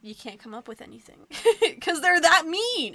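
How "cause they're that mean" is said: laughing it out, much louder. Real, not acted. Daughter laughing at her own mean croissant joke. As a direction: push the punchline through real laughter.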